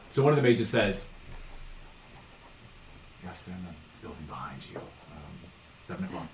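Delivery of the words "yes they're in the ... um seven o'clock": hushed, low robed conspirator; low-voiced mage. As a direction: keep it very soft and low, like covert whispering. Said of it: "a lower voice coming from".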